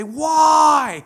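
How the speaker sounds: high, loud cry of why; shouted why. Retold or imitated. Preacher acting out his cry to God, shouting. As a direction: yell it up at the sky. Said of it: "I just say".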